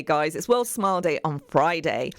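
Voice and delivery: ordinary talk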